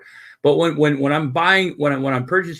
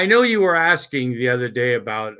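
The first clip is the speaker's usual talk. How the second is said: ordinary talk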